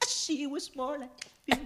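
high, drawn-out sung line. Acted. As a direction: sing it slowly in a high pop falsetto. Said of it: "[name], es un [name] impecable".

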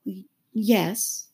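put-on hesitating child voice; doubtful Alice. A narrator's reading. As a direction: stumble into an unsure yes. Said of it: "said Alice doubtfully".

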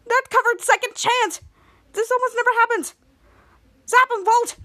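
high-pitched, excited urging; urgent exhortation. Acted. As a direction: press a teammate to seize the chance. Captain urges a second chance.

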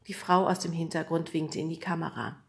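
ordinary delivery, nothing marked

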